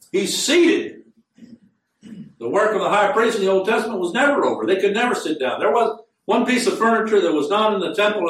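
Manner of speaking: high-pitched, emphatic sermon delivery; impassioned preaching. Real, not acted. Preaches at full force.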